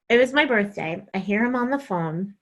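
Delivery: normal talking voice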